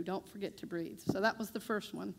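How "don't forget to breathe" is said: a much softer, gentle reminder; soft retold advice. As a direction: hush it like a caring therapist. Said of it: "She said".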